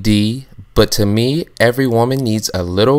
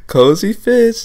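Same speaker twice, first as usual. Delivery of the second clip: much higher, gleeful repeat of "Cozy Fist"; excited squeal; 12 semitones higher, 5 dB louder, slower than usual. Acted. Host squeals the outfit name at the camera in delight. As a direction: squeal it out like you can't contain yourself.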